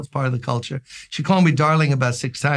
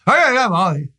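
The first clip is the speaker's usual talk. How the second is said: gruff mock greeting; 5 semitones higher than usual. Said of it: "it's like they're mad".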